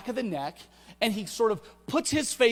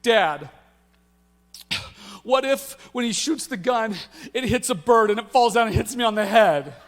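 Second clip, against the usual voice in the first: put-on louder, rising worried kid; anxious daughter; 3 semitones higher, 7 dB louder than usual. Daughter panicking to her dad about the race, worried rising whine. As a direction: pile on the panic like a spiraling kid.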